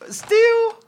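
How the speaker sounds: squeaky high disbelieving Still?; incredulous still. Retold or imitated. Retelling his reply to the drunk guy, high incredulous squeak. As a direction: shoot the word up in mock disbelief.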